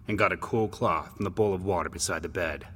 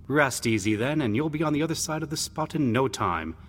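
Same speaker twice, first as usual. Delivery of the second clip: lightly voiced brother, higher pitch; reassuring big brother; 6 semitones higher than usual. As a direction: reassure a sick younger brother, lightly acted. Brother reassures the sick boy.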